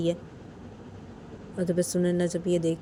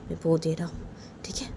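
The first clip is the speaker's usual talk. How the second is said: whisper; 3 semitones lower, 5 dB quieter than usual. Acted. Father mutters mid-quarrel.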